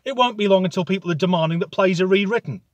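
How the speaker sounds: normal talking voice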